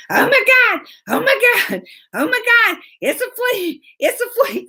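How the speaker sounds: high-pitched, hammed-up panic; freaking out. Child spotting a possible flea, mock panic. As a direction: imitate a child shrieking over a bug. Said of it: "she's freaking out".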